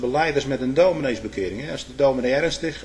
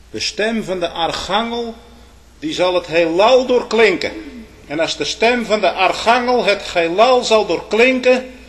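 raised, loud sermon proclamation; ringing warning from the pulpit; forceful preaching; 6 semitones higher, 7 dB louder than usual. Real, not acted. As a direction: preach it out high and strong.

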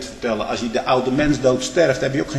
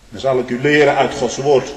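usual speaking voice

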